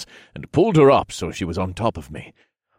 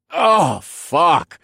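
loud, high moaned curse; moaning; 11 semitones higher, 9 dB louder than usual. A narrator's reading. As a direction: moan it out, overwhelmed. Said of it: "I moaned".

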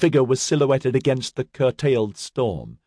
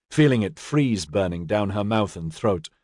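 plain reading voice

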